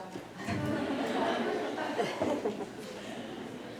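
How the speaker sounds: quiet breathy real laugh; soft laugh. Real, not acted. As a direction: laugh softly under breath. Student comes back, teacher laughs.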